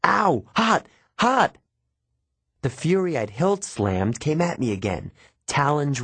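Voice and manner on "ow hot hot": high, loud yelp of pain. A narrator's reading. Grover burned by the whip, high yelping. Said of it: "he yelled".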